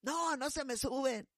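put-on laughing old lady. Old lady laughing off the warning about her sugar. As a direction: brush it off with a laugh.

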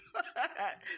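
a quick amused laugh; a brief real chuckle; short laugh. Real, not acted. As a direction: laugh it off lightly. Laughs at own tearful nature.

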